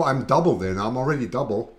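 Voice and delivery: normal talking voice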